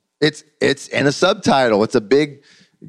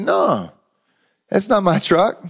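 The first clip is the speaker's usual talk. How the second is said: playful higher son's voice; son teasing; 8 semitones higher than usual. Retold or imitated. Son teasing his father about the new truck, playful and raised. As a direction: tease him, holding back the surprise.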